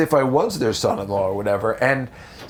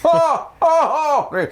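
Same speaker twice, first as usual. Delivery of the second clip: high, louder comic moaning; mock sex moans; 14 semitones higher, 3 dB louder, much slower than usual. Comedian performing his Mister Magoo bit, high hammed-up moans. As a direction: moan it up like a cartoon climax. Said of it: "something like that".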